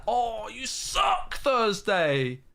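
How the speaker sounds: high, slow, wailing insult; crying shout. Real, not acted. Streamer wailing an insult at opponent Thursday.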